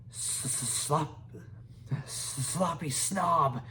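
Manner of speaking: slow, stammering mock insult; little dragon jeering at a rival. A narrator's reading. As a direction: taunt her with relish. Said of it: "jeered Toothless".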